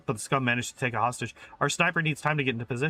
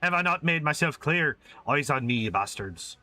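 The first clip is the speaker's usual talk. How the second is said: ordinary delivery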